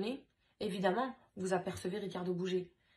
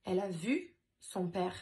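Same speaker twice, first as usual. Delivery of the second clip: ordinary delivery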